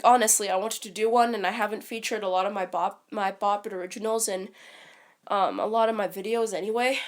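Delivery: normal talking voice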